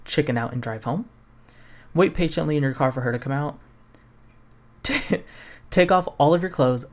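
normal talking voice

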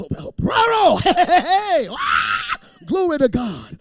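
high, loud celebrating cheers; pretending a phone call win, loud joyful shouts; jubilant shouting. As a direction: whoop like you hit the jackpot. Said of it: "I'm just so".

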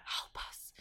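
jokey hushed cry for help, trailing into a whisper; mock whispered plea. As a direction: drop into a soft, voiceless whisper. Mock plea about short Canadian summer.

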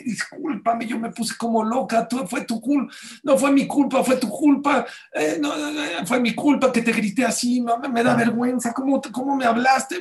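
high, frantic babbling apology; flustered apology. Retold or imitated. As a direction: gush out the apology in a high panicked rush. Woman apologizing frantically on phone.

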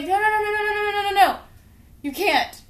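put-on a scared kid blocking the door; frantic refusal.

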